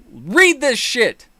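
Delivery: each word spaced out; slow, stressed command. Real, not acted. Telling viewers slowly to read the post. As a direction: drag it out so it lands. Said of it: "demands audience read it".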